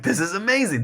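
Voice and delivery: mock-amazed praise, pitched well up; imitated reader enthusiasm. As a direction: gush lightly like an impressed reader. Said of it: "people responded to them".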